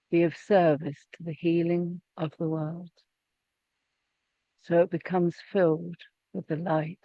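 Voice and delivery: measured prayer, long pauses between phrases; unhurried, meditative prayer with room after each phrase; slow prayer reading. Real, not acted. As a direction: read slowly so others can pray along silently. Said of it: "I'm going to read it slowly".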